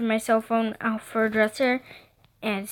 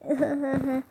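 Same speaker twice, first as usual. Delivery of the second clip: small, soft uneasy giggle; nervous giggle; 7 dB quieter than usual. A narrator's reading. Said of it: "giggled nervously".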